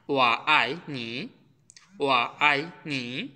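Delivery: ওয়া আই নি said slowly, with a rise on আই; Mandarin demo phrase. Shown as a demonstration. Teacher to students, modelling ওয়া আই নি slowly. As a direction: draw out ওয়া আই নি slowly for learners. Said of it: "we can say some sentence like this".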